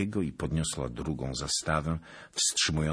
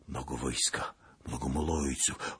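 ordinary delivery, nothing marked

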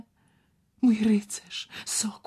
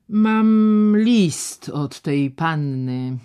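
slow deliberate; 8 dB louder, much slower than usual. Acted. Doctor speaks beyond the door.

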